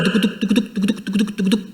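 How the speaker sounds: soft quick tuc-tuc heartbeat imitation; heartbeat sound. Preacher imitating Barabbas's racing heart, soft quick tuc tuc. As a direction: tap out a racing heart, softly. Corazón de Barrabás latiendo a mil.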